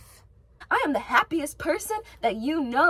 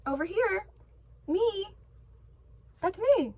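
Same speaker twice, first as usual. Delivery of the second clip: higher, eager calling out; calling for attention; 8 semitones higher than usual. Retold or imitated. Showing how she steps up to be noticed, higher calling voice. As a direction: wave yourself into view, higher. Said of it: "step up and be like".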